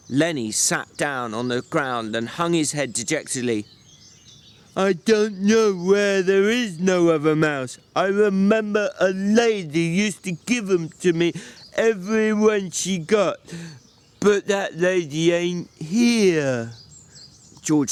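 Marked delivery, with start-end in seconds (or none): 4.7-16.7 slow, higher simple-minded big man; Lenny's slow childlike voice. A narrator's reading. Lenny asking George about supper, slow and childlike. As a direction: plead like a slow, simple giant.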